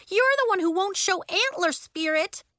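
high, indignant accusation; crying out. A narrator's reading. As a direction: hurl a counter-charge. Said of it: "Nori cried".

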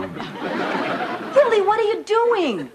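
high, drawn-out disbelief; appalled objection. Acted. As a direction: pull your husband up short, aghast. Wife objects to husband giving in.

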